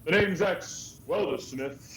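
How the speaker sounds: slow, deliberate name-drop; gruff introduction. Acted. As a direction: introduce yourself slowly like a tough smith. Character introduces himself.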